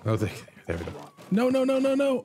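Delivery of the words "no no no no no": high frantic no's. Real, not acted. Missing the target.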